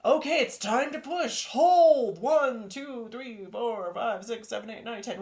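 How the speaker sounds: loud, high mock delivery-room coach; hammed-up movie push count. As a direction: bark the count like a TV midwife. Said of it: "we're trained from the movies".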